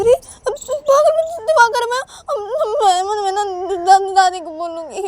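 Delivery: high, loud wailing threat; tearful Uma. Acted. Uma threatening to tell grandmother, acted tearful wail.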